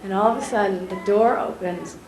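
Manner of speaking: ordinary delivery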